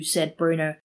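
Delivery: ordinary delivery, nothing marked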